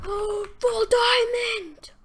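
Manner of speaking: high, loud, drawn-out cheer; excited shout. Real, not acted. Player finding full armor, long excited shout. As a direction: stretch it into a slow, high yell.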